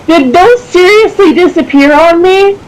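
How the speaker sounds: high-pitched, incredulous laughing complaint; laughing disbelief. Real, not acted. Game master asking if those seriously disappeared, laughing in disbelief. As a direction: voice shoots up, half laughing in disbelief. Finds own game pieces seem missing.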